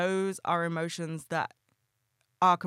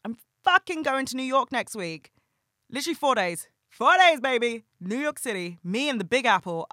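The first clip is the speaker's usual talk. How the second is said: high, loud thrilled burst; can't contain the excitement about the trip; excited outburst; 7 semitones higher, 6 dB louder than usual. Real, not acted.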